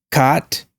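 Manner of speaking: "caught" as "cot", open AH, rising; unrounded AH in "caught"; cot, AH vowel. Shown as a demonstration. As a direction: say "caught" like kaht, tune up. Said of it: "repeating four or five times".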